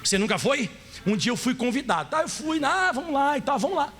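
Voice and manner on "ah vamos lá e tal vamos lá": ordinary talk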